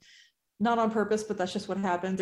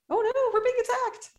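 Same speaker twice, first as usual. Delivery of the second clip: mock alarm; 3 dB louder than usual.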